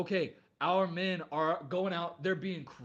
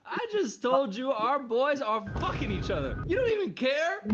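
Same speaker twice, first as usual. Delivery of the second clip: higher, louder mock outrage, ranting; 6 semitones higher, 3 dB louder than usual. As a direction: play the betrayed girlfriend, outraged. Reaction video, acting outraged.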